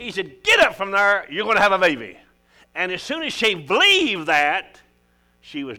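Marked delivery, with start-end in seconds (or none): 0.4-2.2 1 firm declaration. Acted. Priest declares her blessing.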